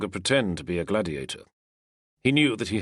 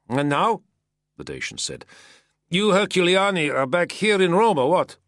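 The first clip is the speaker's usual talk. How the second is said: higher, louder curious soldier; curious Dacian soldier; 10 semitones higher, 4 dB louder than usual. A narrator's reading. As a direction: press him with a friendly question. Dacian asks about Herculiani.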